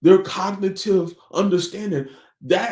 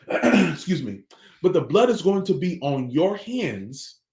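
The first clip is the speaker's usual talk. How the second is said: ordinary delivery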